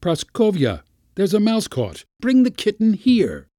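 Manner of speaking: high-pitched summons across the house; calling out. A narrator's reading. Uncle summons Praskovya with the kitten, calling out. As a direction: call her in, voice lifted high. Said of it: "put the mousetrap on the floor and called".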